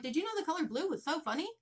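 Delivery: high, loud, rapid giddy nonsense; confused silly rambling. Retold or imitated. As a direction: blurt it out fast and high like a loopy brain. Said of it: "start talking about random things".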